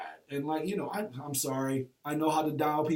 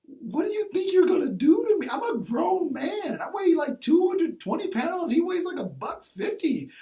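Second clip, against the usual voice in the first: defiant shout; 14 semitones higher, 6 dB louder than usual. Retold or imitated. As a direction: bellow it at him, daring him to come closer. Coach yells back at an angry player.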